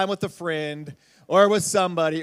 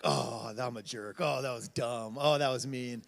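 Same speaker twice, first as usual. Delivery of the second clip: low, hushed regretful inner voice; muttered self-reproach; 8 semitones lower, 7 dB quieter than usual. Retold or imitated. Speaker acting out everyone's moment of regret, muttered self-reproach. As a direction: voice private regret, soft and deep.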